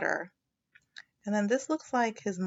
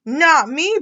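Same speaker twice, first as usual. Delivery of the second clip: higher, loud sputter; Froggy sputtering in the pond; bubbling Froggy; 7 semitones higher, 12 dB louder than usual. Acted. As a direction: sputter it through water. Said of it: "bubbled Froggy".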